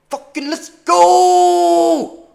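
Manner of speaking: slow, high, loud yell; triumphant shout. Real, not acted. Trader cheering the price on, triumphant shout. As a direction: roar it out.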